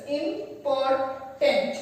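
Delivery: Important said slowly, syllable by syllable; Important, each syllable spelled out slowly; broken into syllables. Shown as a demonstration. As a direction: stretch Important out as im-por-tant. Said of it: "break the word into syllables".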